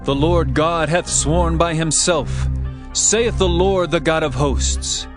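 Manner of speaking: ordinary delivery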